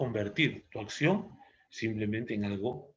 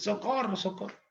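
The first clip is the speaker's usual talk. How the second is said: high, restrained imitation of screams for help; acted distress cries, dropping softer; mock terrible cries; 8 semitones higher, 3 dB quieter than usual. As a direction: cry for help at a high pitch without real volume. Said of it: "gritos así, pero terribles".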